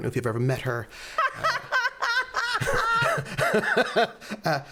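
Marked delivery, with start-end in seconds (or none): 1.6-4.4 high cackling laugh. Real, not acted. GM laughs at own joke.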